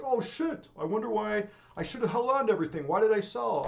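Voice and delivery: high, loud mock wail of regret; mock regretful seller. Streamer mimics a regretful investor, high hammed-up wail. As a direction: ham up a panicked seller kicking himself. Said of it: "They're like".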